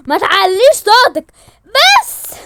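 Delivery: loud, slow warning shout; angry shout. Acted. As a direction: lay down the law. Father shouts back. (زعيق بطيء محذر)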